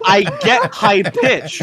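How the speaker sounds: ordinary talk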